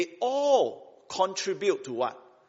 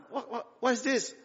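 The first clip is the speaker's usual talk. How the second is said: incredulous; 8 semitones higher than usual. Acted. Voicing a doubter's disbelief.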